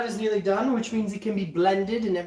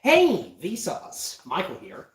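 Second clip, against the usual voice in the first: Vsauce; slower than usual. Acted. Greeting viewers as a video host, mimicked YouTuber intro. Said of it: "straight up Vsauce".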